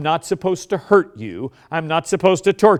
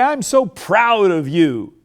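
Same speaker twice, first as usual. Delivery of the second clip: slow, louder, heartfelt praise; full passion; 5 semitones higher, 5 dB louder, slower than usual. Shown as a demonstration. Parent to child, passionate slow praise. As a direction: pour it all in, slowly. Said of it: "grab them by the shoulders and say".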